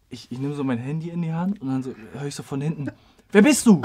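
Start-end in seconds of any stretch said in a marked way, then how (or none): 3.3-3.8 loud, raised-pitch retold demand; mock challenge. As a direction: imitate a startled stranger calling out sharply. Startling challenge voiced in the story.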